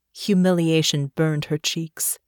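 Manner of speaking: usual speaking voice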